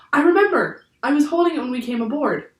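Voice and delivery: high, loud burst of excitement; sudden realisation. A narrator's reading. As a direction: exclaim as the memory returns. Jack suddenly remembers the backpack.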